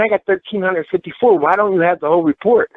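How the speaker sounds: high, pressing questions; retold heated confrontation; indignant challenge. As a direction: push back hard on them. Confronting officials in retelling.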